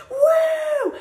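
high, loud whoop; imitated roar of noise; crowd whoop. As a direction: belt out a sudden high "woo". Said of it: "all I hear is".

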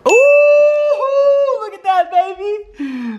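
high, thrilled and showing off; excited gloating. Real, not acted. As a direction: crow over the win, up high. Gloating over a perfect stamp.

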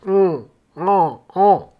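very slow, groggy half-awake grunt; sleepy mumble. Retold or imitated. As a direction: answer like you're still asleep. Half-asleep girlfriend answering.

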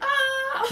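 short high-pitched mock shriek; mock scream. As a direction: imitate a startled scream, high and loud. Imitates a panicked reaction to God.

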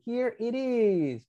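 slow, drawn-out "Here it is"; slow practice model. Shown as a demonstration. Teacher has the class practise the seller's line, slow model. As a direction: model it slowly for repeating. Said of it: "A ver, practiquen".

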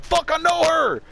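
mock astonished yelp, stretched out; imitating barflies who suddenly recognise her; sudden recognition. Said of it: "people be like".

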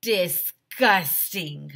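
slow drawn-out disgust. Acted. Kid reader reacting to the story with disgust. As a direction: drag out the word in disgust.

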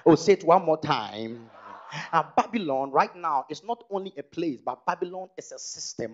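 usual speaking voice

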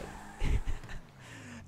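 silent airy laughter; real wheezing laugh; breathless wheeze laugh. Real, not acted.

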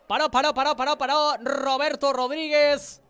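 loud repeated save call; excited save call. Real, not acted. Save called out. (grito de parada emocionado)

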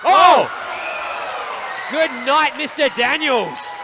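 loud high excited call. Real, not acted. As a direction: erupt as the move lands. Big move, commentator erupts.

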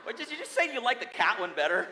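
fast, laughing and loud, high up; mock-wounded laughing protest; laughing disbelief. Real, not acted. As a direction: blurt it out through a laugh. Laughing reaction to audience.

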